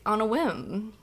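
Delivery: usual speaking voice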